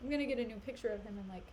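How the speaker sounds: normal talking voice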